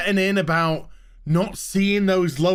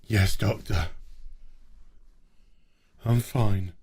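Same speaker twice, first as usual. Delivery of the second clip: much lower, softer deadpan reply; mock flat crewman; 12 semitones lower, 4 dB quieter than usual. Ranter mimicking a crewman brushing off the doctor, low deadpan. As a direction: drone it like a hollow crewman. Mocking crew answering doctor.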